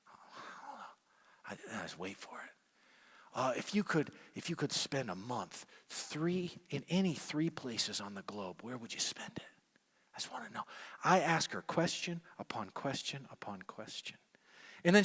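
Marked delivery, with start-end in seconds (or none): 0.1-2.5 whispered aside. Retold or imitated. 8.6-10.6 whispered question. Retold or imitated.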